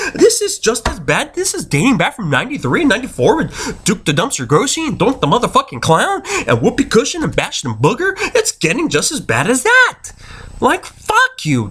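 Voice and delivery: high, worked-up tirade; fed-up fan losing his cool; angry rant. Real, not acted. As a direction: rant with the pitch swinging up and down. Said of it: "Anyway, sorry about that".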